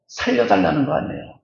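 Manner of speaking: normal talking voice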